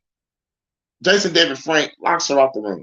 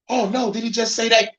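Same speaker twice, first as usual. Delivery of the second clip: high-pitched pretend gasping disbelief; mildly hammed-up shocked reaction; mock shock; 10 semitones higher than usual. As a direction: imitate a scandalized onlooker, up high. Mimicking a shocked audience reaction.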